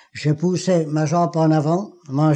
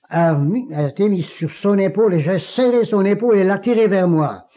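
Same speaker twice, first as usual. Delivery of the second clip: ordinary talk, nothing marked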